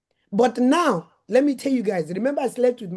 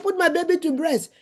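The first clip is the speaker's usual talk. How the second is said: a sharp, high demand to the nurses; demanding, raised pitch; 8 semitones higher than usual. Retold or imitated. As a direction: push the pitch up and insist.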